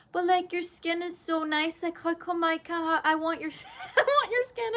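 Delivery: high, drawn-on woozy admiration; woozy gushing. Retold or imitated. Patient admiring someone's skin after waking, woozy gushing. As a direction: lightly imitate herself gushing after waking. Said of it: "I literally woke up".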